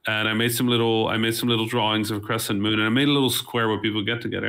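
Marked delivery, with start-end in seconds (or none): none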